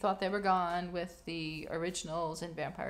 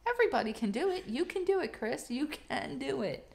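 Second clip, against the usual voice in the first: mock cheer; 7 semitones higher, 3 dB louder than usual. Cheering Chris Pratt on.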